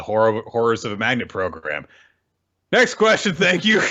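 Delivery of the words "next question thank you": mock brush-off. Jokingly ending his own answer.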